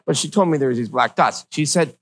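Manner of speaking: normal talking voice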